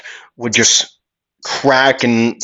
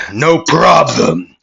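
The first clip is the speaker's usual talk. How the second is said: slow, raised brute voice; big guy's reply; 5 semitones higher, 4 dB louder, slower than usual. Acted. Big villain agreeing to help out, slow and raised. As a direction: drag it out like a hulking henchman. Big guy answers with grunt.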